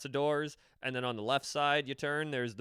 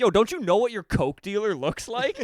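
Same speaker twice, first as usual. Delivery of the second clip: incredulous retort; 8 semitones higher, 10 dB louder than usual. Retold or imitated. Retorting to yelling stranger.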